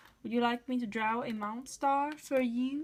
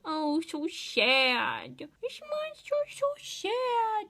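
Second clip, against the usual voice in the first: slow, high, hammed-up pity; overdone sad-for-the-monster voice; mock sad; 9 semitones higher, slower than usual. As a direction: drag it out like fake sympathy.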